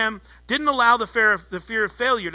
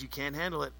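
softer, a hint of a tempting whisper; mock whisper; 6 semitones lower, 12 dB quieter, faster than usual. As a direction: murmur it like the serpent in your ear. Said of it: "he whispers in your ear".

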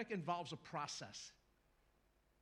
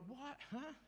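puzzled huh; 9 semitones higher, 5 dB quieter than usual. Acted. Puzzled hearer.

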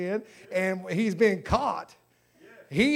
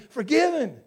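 declaring forgiven; 6 semitones higher, 6 dB louder than usual. Acted.

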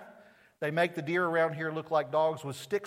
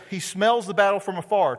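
loud, high, weighty recitation; scripture reading; 4 semitones higher, 7 dB louder than usual. Acted. Reading God's words to Job, a loud weighty proclamation. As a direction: proclaim the verse to the hall.

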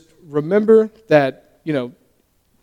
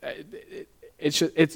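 halting, repeated false starts; stumbling over words; 8 dB quieter than usual. Real, not acted. Preacher losing the thread mid-sermon, stumbling over words. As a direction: trip over the opening words and restart. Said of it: "I'm stumbling over my words".

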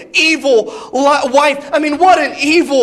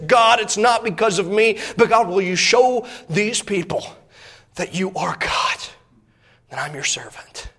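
earnest plea, falling softer and lower to a hush; retold prayer; 3 semitones lower, 4 dB quieter than usual. Elijah bows before all the people and prays, humble hushed prayer. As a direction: pray it humbly, letting the voice sink. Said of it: "and he prays".

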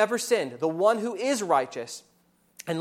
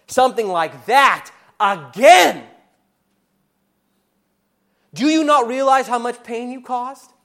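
loud, fast, high accusing voice; a condemning voice screaming in your face; accuser yelling; 4 semitones higher, 7 dB louder than usual. Acted. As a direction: hurl the accusations at them. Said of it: "the one who is serving you is screaming at your face".

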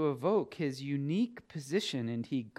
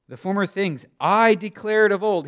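loud, emphatic scripture quote; emphatic declaration; 4 semitones higher, 11 dB louder than usual. Acted. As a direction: stress on "I", much louder. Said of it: "He says it emphatically".

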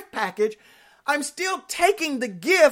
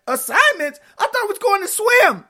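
put-on kid's voice jumps higher, dismayed; surprised kid; 7 semitones higher, 3 dB louder, faster than usual. Child shocked to hear about summer school assignments. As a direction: act the shocked kid who wanted to swim.

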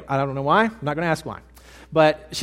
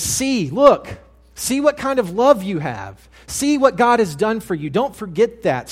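higher-pitched, emphatic preaching; urgent exhortation; 4 semitones higher, 4 dB louder than usual. Real, not acted. Preacher urging the congregation to see God's love, urgent exhortation. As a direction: press each command home with raised voice.

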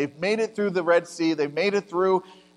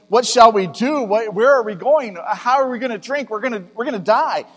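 higher, louder panicked grumbling; thirsty people accusing Moses, in a raised complaining voice; complaining Israelites; 5 semitones higher, 4 dB louder than usual. Acted. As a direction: protest to Moses as the scared crowd. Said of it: "they're complaining to Moses and saying".

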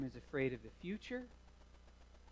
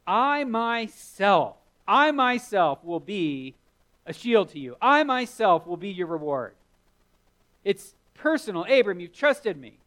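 emphatic voice of God; 5 semitones higher, 7 dB louder than usual. Acted. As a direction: declare each I myself like an unbreakable vow. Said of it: "and God is emphatic".

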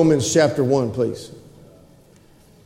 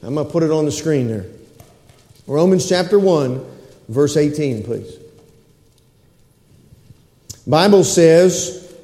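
ordinary talk, nothing marked

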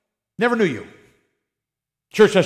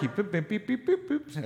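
imitated fast-forward beeps; 2 semitones higher, 11 dB quieter than usual.

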